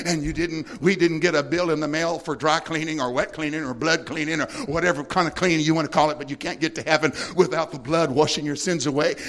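ordinary talk, nothing marked

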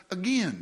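"again" said as agin, one short g and a nasal e; Deep South agin. Shown as a demonstration. Preacher says "again" the Deep South way. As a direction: drop the first sound, say "again" like agin. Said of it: "as they say in the Deep South".

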